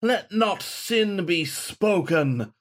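high, slow, lofty decree; declaiming. A narrator's reading. Ghostly saint declaiming over the shaman. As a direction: proclaim it like scripture. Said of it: "Saint Galahad declaimed".

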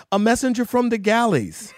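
high, loud, laughing mock stage announcement; hammed-up messenger line. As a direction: deliver a tiny part grandly. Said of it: "my line was".

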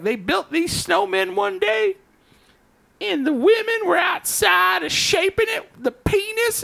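high, slow outraged neighbor voice; angry old neighbor. Retold or imitated. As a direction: rant like a scandalised neighbor.

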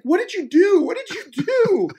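imitating a very high, aghast squeal; hammed-up horror in a high voice; alarmed agent. Agent questioning actor after audition, mock alarm.

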